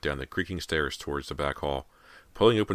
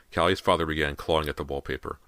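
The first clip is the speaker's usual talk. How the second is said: usual speaking voice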